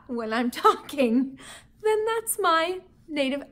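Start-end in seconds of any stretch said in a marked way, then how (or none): none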